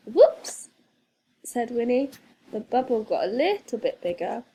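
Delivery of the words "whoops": high, loud startled whoops; surprised whoops. A narrator's reading. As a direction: yelp it in sudden surprise. Winnie breaks wind in bubble.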